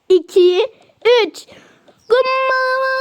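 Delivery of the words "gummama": high, stretched-out whoop; jumping cry. Pretend. Leaping together after counting to three, jumping cry. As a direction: draw it out as you leap.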